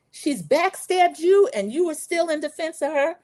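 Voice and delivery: high mock-outraged voice; Kimmy accusing. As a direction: imitate an upset friend's accusation. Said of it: "trying to do their accents and these loud, horrible arguments".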